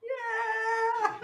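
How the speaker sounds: high laughing whoop; laughing cheer. Real, not acted. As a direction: burst out laughing on it.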